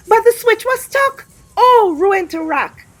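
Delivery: high, loud cry of dismay; dramatic alarm. A narrator's reading. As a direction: belt out the disaster moment. Switch stuck, disaster looming.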